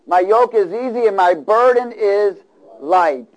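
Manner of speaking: slow, high, loud scripture; preaching. Acted. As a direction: proclaim it slowly. Quoting Jesus emphatically.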